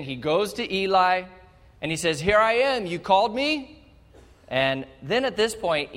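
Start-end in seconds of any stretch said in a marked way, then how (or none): 2.2-3.8 put-on eager boy. Third call to Eli.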